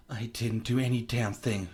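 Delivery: ordinary delivery, nothing marked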